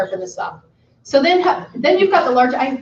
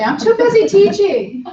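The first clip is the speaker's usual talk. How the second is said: higher, slow, stressing "busy"; drawn-out insistence on "busy"; emphatic; 9 semitones higher, 4 dB louder, slower than usual. A narrator's reading. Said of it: "the man says emphatically".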